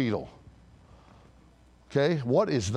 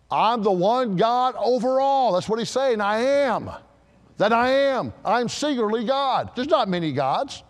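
full-force preaching; 7 semitones higher, 3 dB louder than usual. Acted. God declaring himself the one God, thundering proclamation. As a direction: preach it high and emphatic, drawing out the words.

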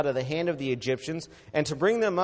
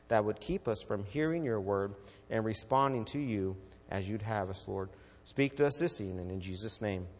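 soft, low, reverent prayer voice; quiet prayer; 7 semitones lower, 6 dB quieter than usual. Acted. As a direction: pray quietly to the Father. Pastor prays before sermon.

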